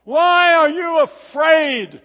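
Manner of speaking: loud, slow yell over the storm; Jesus yelling to frightened disciples over the gale; yelled question. Acted. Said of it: "he had to yell it at 'em".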